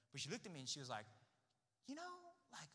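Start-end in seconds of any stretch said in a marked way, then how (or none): 1.9-2.7 much higher, lightly voiced girl; imitating the girl. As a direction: pitch way up to play her. Quoting the girl's reply.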